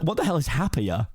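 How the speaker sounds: ordinary delivery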